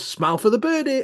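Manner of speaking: mock cheery photographer call; high photographer voice.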